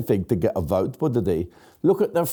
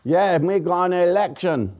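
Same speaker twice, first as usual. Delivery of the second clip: mock Chinese accent; 6 semitones higher than usual. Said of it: "I didn't know he had a Chinese accent".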